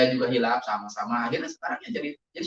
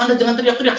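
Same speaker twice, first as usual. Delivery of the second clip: loud, raised-pitch reprimand; retold scolding; 5 semitones higher, 8 dB louder, faster than usual. Man retelling how he scolded someone yelling about God, stern. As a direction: tell off a shouter firmly.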